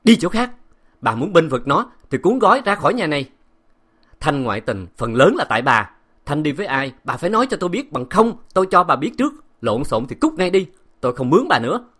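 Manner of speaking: high, loud, furious master; angry shout. A narrator's reading. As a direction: roar at the maid. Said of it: "đẩy mạnh bà Ngô qua một bên quát".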